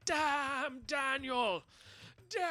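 high-pitched drawn-out mock scream; hammed-up high wail of a scream. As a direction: scream it high, stretching each word. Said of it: "you just hear him screaming".

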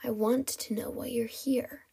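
soft, breathy villain; ghostly whisper. Acted. Kidnapper questions his captive, ghostly whisper. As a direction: demand it in a hush. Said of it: "the ghostly whisper".

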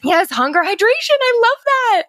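high, loud eager agreement; thrilled to add the word; excited. Real, not acted. Guest eagerly agrees with host's correction, excited.

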